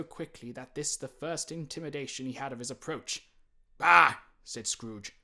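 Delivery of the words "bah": loud, scornful snap; scornful bah. A narrator's reading. Scrooge brushes off his nephew's greeting, loud scorn. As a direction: spit it out in contempt.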